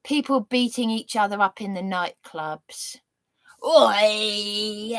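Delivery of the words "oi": a rough imitated oi shout; mock shout. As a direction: bark it like a pub brawler. Imitating nightclub brawlers.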